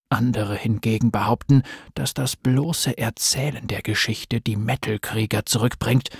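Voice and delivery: low, hushed, ominous storyteller; horror trailer voice. A narrator's reading. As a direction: tell it like a dark campfire legend. Dark legend told at fireside. (tiefe, gedämpfte Horror-Erzählstimme)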